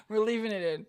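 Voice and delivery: loud, amused through laughter; gleeful, laughing out loud. Real, not acted. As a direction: crack up at the co-host's slip. Laughing at co-host's slip.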